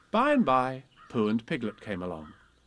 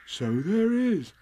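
slow, higher, drawn-out; wondering; 9 semitones higher, 4 dB louder, much slower than usual. A narrator's reading. Pooh looks up at the pine tree, slow wonder. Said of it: "looking up wonderingly".

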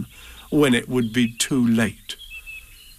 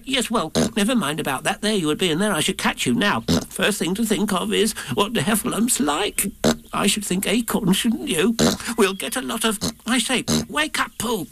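high, fussy Piglet voice climbing higher; squeaky Piglet; 8 semitones higher than usual. Acted. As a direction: Piglet bustling, cutting Pooh off. Piglet takes over, voice rising.

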